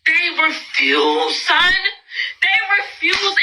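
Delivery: high, slow, strained disbelief at the mess; exasperated. Real, not acted. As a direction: near tears over the mess. Exasperated rant about messy kids.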